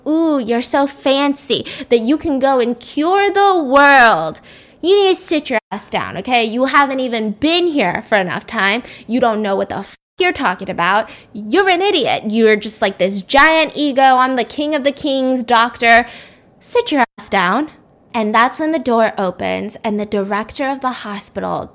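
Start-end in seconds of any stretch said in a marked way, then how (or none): 0.1-4.4 drawn-out sarcastic sneer, building to a loud burst on the last word; mock him as a fancy know-it-all; slow taunting voice that explodes on the final word. Boss mocks the young doctor's ego. 4.8-17.7 retold yelling, louder than his own talk; angry boss rant. Boss chews out the young doctor.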